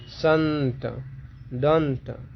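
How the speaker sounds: संत and दंत slow, the nasal n held long; anusvara demonstration. Shown as a demonstration. Teacher showing anusvara, long n in संत and दंत. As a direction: lean on the n in संत and दंत, stretching it. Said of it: "उच्चारण कुछ जोर से".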